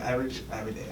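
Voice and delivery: soft deadpan imitation; imitated straight face. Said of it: "she be saying it with a straight face".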